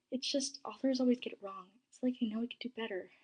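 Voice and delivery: ordinary delivery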